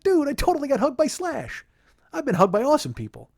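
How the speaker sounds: high, loud, excited squeal of a fan; giddy fan gushing. Retold or imitated. Bragging about a hug from [name], giddy fan gushing. Gushes over the retold hug.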